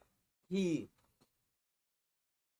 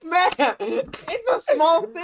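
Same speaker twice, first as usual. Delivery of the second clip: high, loud, slow, laughing; laughing protest; 13 semitones higher, 11 dB louder, slower than usual. Real, not acted. Defends story amid laughter.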